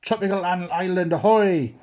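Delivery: high, loud captain's call; hearty storybook "ahoy"; shout. A narrator's reading. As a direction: call it out from the crow's nest. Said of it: "the captain shouted".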